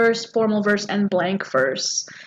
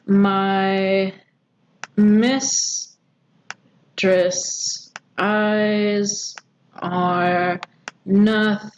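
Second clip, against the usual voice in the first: each syllable held alone, long pauses between; deliberate, metronomic verse scansion; scanning syllable by syllable; much slower than usual. Shown as a demonstration. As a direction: chant "My mistress' eyes are nothing like the sun" one slow syllable at a time. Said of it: "just to show you here".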